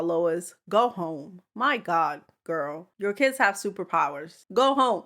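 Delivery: ordinary delivery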